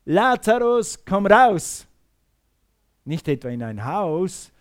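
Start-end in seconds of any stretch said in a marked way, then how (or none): 0.0-1.9 raised, louder biblical command; loud call. Acted. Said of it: "rief er mit lauter Stimme".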